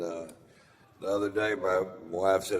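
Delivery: normal talking voice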